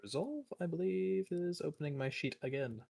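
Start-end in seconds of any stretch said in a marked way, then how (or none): none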